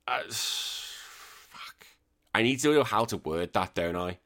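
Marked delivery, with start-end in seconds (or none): 0.1-1.9 exasperated sigh, then a breathy whispered "fuck"; whispered sigh and curse. Real, not acted. Frustrated over wording email.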